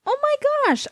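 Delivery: high squealing yell; high excited yell. A narrator's reading. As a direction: squeal over the noise. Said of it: "I yelled".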